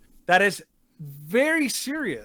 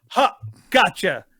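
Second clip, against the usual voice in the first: slow, smug mocking gotcha; 3 semitones higher, 5 dB louder, slower than usual. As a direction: gloat like you won. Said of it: "used this as their".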